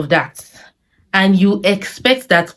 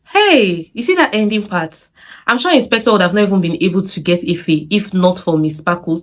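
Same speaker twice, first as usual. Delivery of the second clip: ordinary delivery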